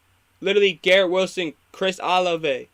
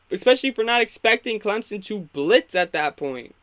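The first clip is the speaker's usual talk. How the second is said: ordinary talk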